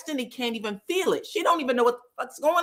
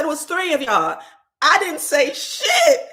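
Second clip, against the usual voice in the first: high, loud indignant denial; high loud mock outrage; indignant Destiny; 7 semitones higher, 6 dB louder than usual. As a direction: protest high and loud, scandalized. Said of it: "She said".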